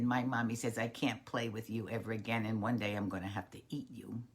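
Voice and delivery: low, soft, downcast confession; sad little snake. Acted. Snake tells Frog what mother said, sad. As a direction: break the bad news low and soft. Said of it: "Snake was so sad".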